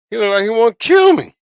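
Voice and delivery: high, loud comic disbelief; amused exclamation. Real, not acted. Telling viewers about a stranger at the store, amused exclamation. Funny retelling of scary stare.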